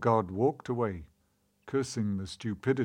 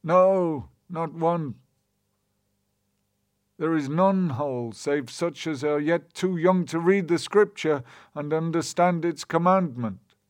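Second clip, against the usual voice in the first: higher, louder crowd answer; townsfolk answer; 6 semitones higher, 4 dB louder than usual. A narrator's reading. The people answer together.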